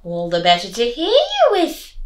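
sly wolf in a granny voice; wolf replied. Acted. Disguised wolf answering the girl, sly wolf voice. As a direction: answer smoothly, hiding the wolf. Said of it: "the wolf replied".

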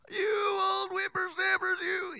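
put-on high, slow creaky old man; old grandpa voice. Shown as a demonstration. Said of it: "Just like old grandpa".

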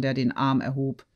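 usual speaking voice

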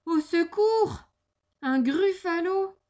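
high, loud, acted cry of fright; alarmed cry for help. The mouse comes face to face with the gruffalo, alarmed cry. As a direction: cry out at the monster, drawn out. Mouse suddenly meets the monster.

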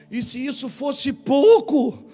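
pleading heavenly rebuke; voice of God rebuking. Acted. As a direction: let God's disappointment ring out. God rebuking David, preacher voicing.

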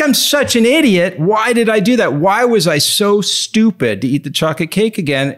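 self-scolding. Retold or imitated. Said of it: "I'm like".

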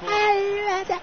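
very high mock sob; hammed-up tearful curse; fake crying. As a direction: whimper it with faked tears. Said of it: "Jeg klarte ikke å få fake tårer".

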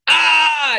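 sudden, very high, loud scream; imitated child scream. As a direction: burst out with a piercing yell. Boy suddenly starts yelling.

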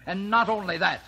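enraged father; furious scolding. Acted. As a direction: forbid it outright. Father forbids revenge.

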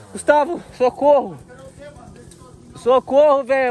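loud cry for help; calling for help. Real, not acted. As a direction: shout for your friend in panic.

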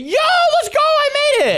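high triumphant cheer; excited shout. Real, not acted. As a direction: yell it like you just scored. Scoring a dunk in game.

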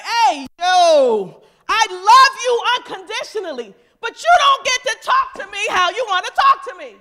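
loud acted telling-off; firm loud boundary. Loved one demanding respectful talk, loud acted firmness. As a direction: lay down the law loudly. Acts out someone setting a boundary.

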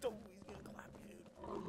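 fast, soft whispered warning; imitated cop. Imitating a cop warning of a clap, rapid whisper. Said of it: "The cop's like".

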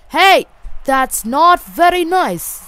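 high, loud gamer protest. Real, not acted. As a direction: call out your complaint, drawing it out. Protesting at the game.

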